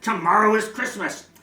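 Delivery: snarl. Acted. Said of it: "He snarled with a sneer".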